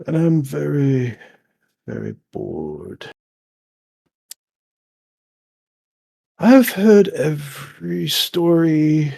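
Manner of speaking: slow, ponderous river creature; imperious, bored old being, speaking slowly; bored ancient river lord. Acted. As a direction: drag the words out with long pauses.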